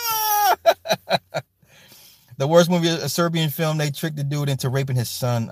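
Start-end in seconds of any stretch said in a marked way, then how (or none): 0.1-1.4 mock high scream. Retelling the movie character's scream, high mock scream. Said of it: "he's like".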